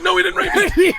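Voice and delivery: high, loud protest with laughter in it; hammed-up indignant dad; exasperated denial. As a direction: shout it down in comic disbelief. Retold exasperated denial to daughter.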